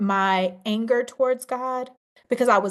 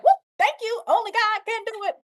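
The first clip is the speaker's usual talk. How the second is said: joyful praise; 11 semitones higher than usual. Retold or imitated. Walking out after good news, joyful cry of praise. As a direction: burst out thanking God. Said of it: "I'm happy".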